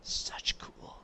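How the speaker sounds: hushed, breathless awe; awed whisper. Real, not acted. Gamer admiring new throwing knives, awed whisper. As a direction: whisper it in wonder.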